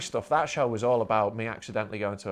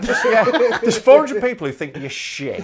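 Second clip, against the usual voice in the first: laughing; 9 semitones higher, 10 dB louder than usual. Real, not acted. Laughing while telling story.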